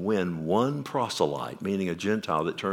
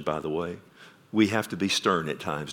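usual speaking voice

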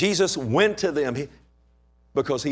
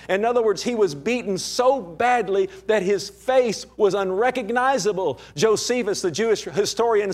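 higher, impassioned preaching voice; preaching at full force; 5 semitones higher than usual. Acted. Preacher to his congregation, impassioned full-force preaching. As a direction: plead with the Lord from the pulpit, building. Preacher pleads with Lord again.

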